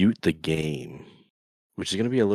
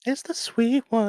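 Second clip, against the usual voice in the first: squeaky high voice; 5 dB louder than usual. Pretend. Echoing in high voice.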